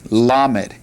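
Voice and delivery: "Lamed" said as LAH-med, stress on the first syllable, falling; teacher modelling "Lamed"; pronouncing lamed. Shown as a demonstration. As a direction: model "Lamed" clearly for the class to repeat. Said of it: "Say that with me".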